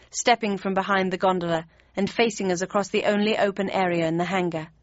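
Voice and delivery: ordinary delivery, nothing marked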